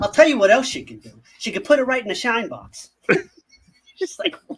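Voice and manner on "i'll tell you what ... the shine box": put-on Boston accent. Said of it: "That fucking Boston accent was fucking high flying".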